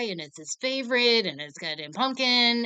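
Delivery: ordinary talk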